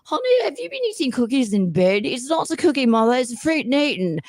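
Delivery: put-on high-pitched theatrical English accent. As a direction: put on a hammy British commercial voice. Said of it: "I do the English accent".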